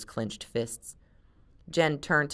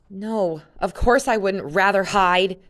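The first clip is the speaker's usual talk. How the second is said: irritable; 4 semitones higher, 7 dB louder than usual. Acted. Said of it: "Jen said irritably".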